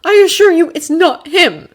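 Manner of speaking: put-on high, loud haughty boy voice; Draco voice. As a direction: promise it with a sneer.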